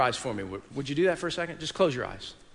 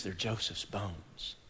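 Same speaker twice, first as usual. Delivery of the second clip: much lower, softer and slow, a hushed grave reply; solemn Moses; 7 semitones lower, 12 dB quieter, much slower than usual. Acted. Moses answering the people about the box, low and hushed. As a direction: let Moses drop it quietly, weighty and slow.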